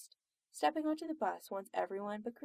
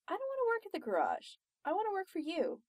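high-pitched, eager insistence; insistent plea; 9 semitones higher, faster than usual. Acted. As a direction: press for the job in a high voice. Pressing for a job.

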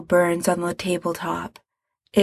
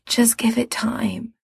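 faint whispered plea; barely voiced, feverish; weak whisper; 4 semitones higher, 3 dB quieter than usual. Sick Mikey reassuring.

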